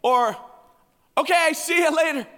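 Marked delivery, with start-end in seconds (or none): 1.2-2.3 high, dismissive goodbye; mock cheery brush-off; breezy sign-off. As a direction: toss it over your shoulder on the way out. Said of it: "And then it turns into".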